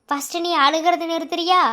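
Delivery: a loud, exasperated demand; exasperated shout. Acted. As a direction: snap at her to stop crying. Exasperated at her crying. (அழுகையை நிறுத்தச் சொல்லும் எரிச்சல் குரல்)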